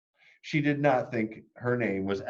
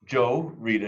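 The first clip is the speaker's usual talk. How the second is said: ordinary delivery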